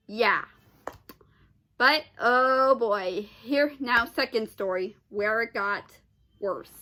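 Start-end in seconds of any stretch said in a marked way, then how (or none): none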